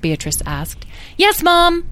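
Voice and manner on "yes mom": put-on high, loud eager child voice; excited chorus. A narrator's reading. Two draglings answer their mother, excited. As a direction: chirp obediently, full of excitement. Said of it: "chorused Lyle and Edwin excitedly".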